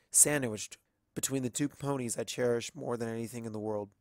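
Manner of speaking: usual speaking voice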